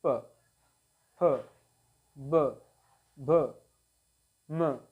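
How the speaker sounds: प, फ, ब, भ, म one at a time, lips touching; lip consonant demonstration. Shown as a demonstration. Teacher showing learners how both lips meet, demonstrative articulation. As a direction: give each labial its own beat, long pauses between. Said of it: "ओंठ होता है एक दूसरे से touch".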